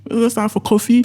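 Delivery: mock bewildered double take; confused surprise. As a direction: pitch climbing in disbelief. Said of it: "in my head, I was like".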